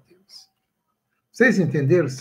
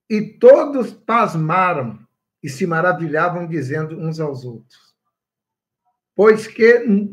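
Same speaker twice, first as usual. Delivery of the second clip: scripture reading; 3 semitones higher, 6 dB louder than usual. Acted. Pastor reading Acts to the congregation, solemn and loud. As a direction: read the verse out like a preacher.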